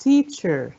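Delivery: "teacher" as TEA-cher, falling; stress on first syllable. Shown as a demonstration. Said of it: "على أول مقطع برضو".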